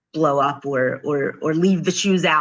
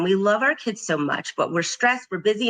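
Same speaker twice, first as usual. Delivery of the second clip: normal talking voice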